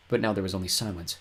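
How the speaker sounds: ordinary delivery, nothing marked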